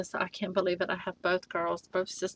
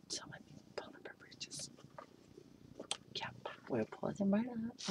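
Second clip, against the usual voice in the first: whisper; 5 semitones higher, 17 dB quieter than usual. Real, not acted. Pulling up doll's britches.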